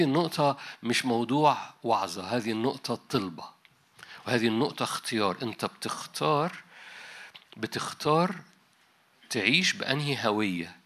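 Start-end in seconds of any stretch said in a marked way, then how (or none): 5.4-8.3 بتختار lifted higher, slowed, rising; emphatic repeated choice. Real, not acted. Preacher urging the congregation to choose, rising emphasis. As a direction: lean on بتختار twice, asking.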